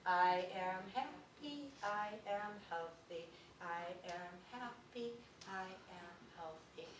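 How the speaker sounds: slow, deliberate affirmation spoken aloud, fading; slow out loud. Shown as a demonstration. Teacher leading the class aloud again, slowly. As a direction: speak it slowly, letting it fade. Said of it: "Back to twice out loud".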